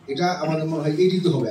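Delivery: ordinary delivery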